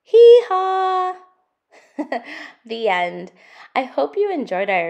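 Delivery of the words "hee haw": loud, high braying hee-haw; donkey bray. Acted.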